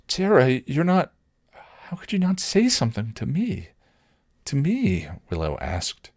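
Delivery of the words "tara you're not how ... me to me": high-pitched, halting hurt disbelief; stricken, near tears. A narrator's reading. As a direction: plead in wounded disbelief, on the edge of tears. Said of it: "stricken and near tears".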